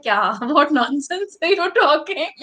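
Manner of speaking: imitating a younger self's laughing disbelief; laughing scoff. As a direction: scoff through a laugh, pitch climbing. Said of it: "I used to laugh".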